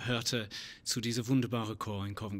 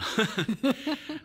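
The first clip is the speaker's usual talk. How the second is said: laughing; 13 semitones higher, 5 dB louder than usual. Real, not acted. Guest laughs at himself.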